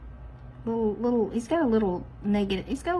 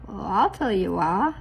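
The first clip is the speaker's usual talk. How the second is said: ordinary delivery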